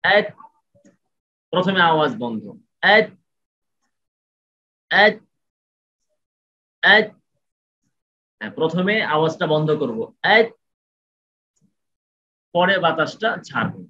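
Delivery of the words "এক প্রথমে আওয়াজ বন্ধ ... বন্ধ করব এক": এক said higher and louder, cut off sharply; stopped sound demo. Shown as a demonstration. Tajweed teacher showing the stopped এক. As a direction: clip এক short and firm. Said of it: "সাকিন যখন হবে তখন এভাবে হবে".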